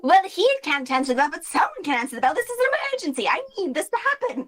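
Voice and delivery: high, fast entitled insistence; frantic Celia. Acted. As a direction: rattle it off high and urgent.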